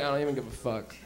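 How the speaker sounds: low, soft don't-care mumble; stoned indifference. Retold or imitated. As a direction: drop it low and quiet, totally checked out. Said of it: "You're all high, you're just listening".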